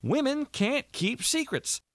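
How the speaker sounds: high, slow, emphatic pronouncement; agitated Walter. A narrator's reading. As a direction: insist in a strained high voice.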